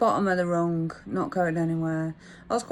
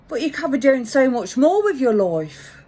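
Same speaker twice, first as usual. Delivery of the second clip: loud, higher imitation London accent; 6 semitones higher, 8 dB louder than usual. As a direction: push hard in a mock London accent. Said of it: "he said in his London accent".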